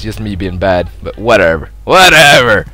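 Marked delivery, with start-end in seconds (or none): 1.8-2.7 exasperated dismissal. Real, not acted. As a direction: throw WHATEVER out loudly. Repeats it louder.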